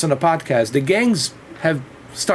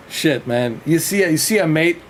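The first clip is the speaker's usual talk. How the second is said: usual speaking voice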